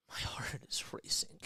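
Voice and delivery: breathless soft whisper. Real, not acted. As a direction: hush it out, still shaken. Shaken after overtime finish.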